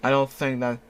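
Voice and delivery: ordinary delivery, nothing marked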